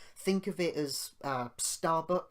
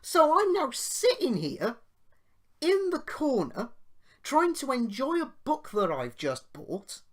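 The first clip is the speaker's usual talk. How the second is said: higher and louder, outraged retelling; indignant rant; 6 semitones higher, 5 dB louder than usual. Real, not acted. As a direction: fume about the cheek of it all. Indignant rant about the insult.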